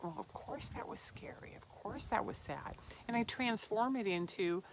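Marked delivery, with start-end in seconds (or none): 0.0-2.8 tender whisper. Retold or imitated. Soothing her inner child.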